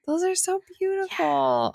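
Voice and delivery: gasp, then gush with delight; excited gasp. Real, not acted. Delighted reaction to tattoo.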